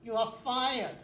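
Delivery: loud, angry boss yelling fired; boss shouting. Acted. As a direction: bark the firing at full volume. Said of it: "from behind me I hear".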